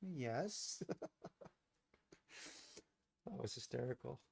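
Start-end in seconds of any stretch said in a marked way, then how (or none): 0.0-0.8 lightly imitated mother, soft and higher; a hint of mom, small and raised; mom's answer. Mom answering the drunk guy by phone, lightly imitated. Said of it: "my mom's like".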